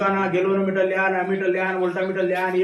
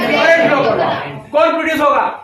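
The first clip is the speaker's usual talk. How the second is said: excited teacher calls; 5 semitones higher, 5 dB louder than usual. Real, not acted. Excited classroom call and response.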